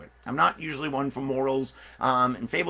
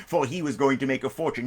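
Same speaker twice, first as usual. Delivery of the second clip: plain reading voice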